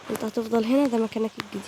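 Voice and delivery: ordinary talk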